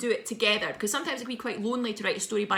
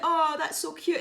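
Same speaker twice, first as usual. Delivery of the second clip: cooing; 7 semitones higher than usual. Retold or imitated. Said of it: "And I was like".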